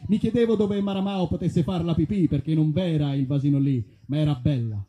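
plain reading voice

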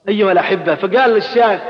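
ordinary talk

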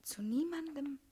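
soft unvoiced vow; hushed solemn promise; whispered promise. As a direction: swear secrecy in a hush.

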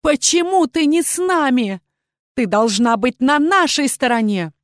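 high, indignant shout; outraged queen's cry. A narrator's reading. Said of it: "И тут же закричала".

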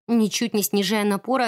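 ordinary delivery, nothing marked

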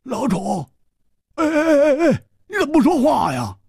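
high-pitched panicked stammering; alarmed cry. A narrator's reading. Hu calls to his silent friend, high and panicked. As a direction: call to him in rising alarm, tripping over words. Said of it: "失声说".

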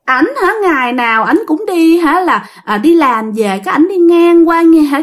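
put-on high, simpering girlish voice; coy woman spirit. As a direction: coo it like a lovestruck ghost girl. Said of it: "nói giọng đàn bà luôn ... yểu điệu ẹo vô cùng".

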